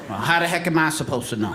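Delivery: ordinary delivery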